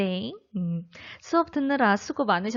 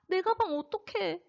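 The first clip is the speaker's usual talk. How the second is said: 당황; 9 semitones higher, 4 dB quieter than usual. Acted. 가방 잃은 상황극.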